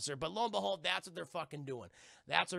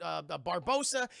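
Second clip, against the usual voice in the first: normal talking voice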